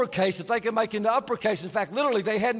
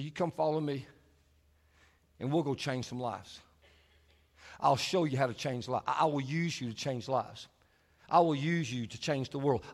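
lower, softer, tender Jesus voice; 8 semitones lower, 5 dB quieter than usual. Acted. Jesus inviting the fishermen: low, soft, tender. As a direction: speak the promise gently and low. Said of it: "He said".

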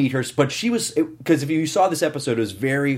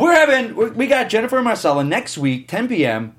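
excited announcement; 8 semitones higher than usual. Real, not acted. Host announcing next week's guests to listeners, excitedly. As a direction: announce it like a party invitation.

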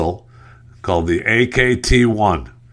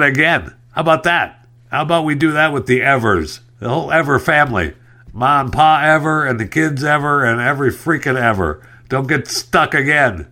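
raised, exasperated scolding of the whole ship family; 5 semitones higher than usual. Real, not acted. Host scolding the Ever ships for getting stuck again, exasperated. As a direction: tell the ships off, fed up.

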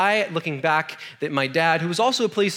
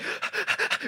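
sharp breathless gasp; voiceless horrified gasp; shocked gasp; 8 dB quieter than usual. Retold or imitated. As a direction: suck in air in horror. Showing friends the bone.

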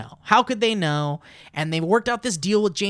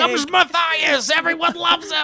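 ride announcer; 8 semitones higher, 5 dB louder than usual. Pretend.

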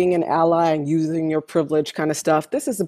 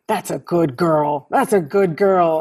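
put-on slow, drawn-out old man's praise; a lightly voiced old man calling from his truck; mimicking old man. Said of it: "he goes".

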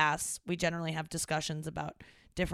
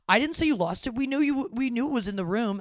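loud, higher defensive denial; defensive dad; 6 semitones higher, 8 dB louder than usual. Retold or imitated. Dad loudly denying he blamed his daughter. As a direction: yell it back in defense. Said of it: "He goes".

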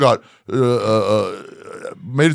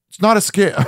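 high, emphatic mock insistence; insistent denial; 11 semitones higher, 4 dB louder than usual. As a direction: imitate him swearing it's legit. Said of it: "he was like".

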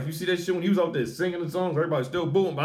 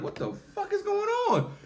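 high-pitched, softer incredulous question; baffled disbelief; 7 dB quieter than usual. Retold or imitated. Host retelling his reaction to the boos, high baffled voice. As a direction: squeak it in mock bewilderment.

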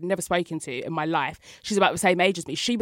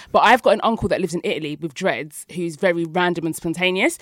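ordinary talk, nothing marked

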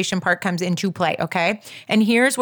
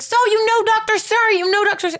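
high-pitched eager confirming; excited; 11 semitones higher, faster than usual. Retold or imitated. Patient eagerly confirms she knows Doctor Suri, excited. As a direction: retell your thrilled question, voice way up. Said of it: "Why is everybody so excited?".

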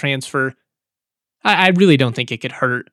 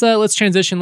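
ordinary delivery

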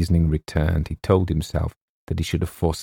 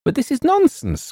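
high-pitched indignant outburst; agitated cry. A narrator's reading. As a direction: cry out in disbelief, pitch shooting up. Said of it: "cried James".

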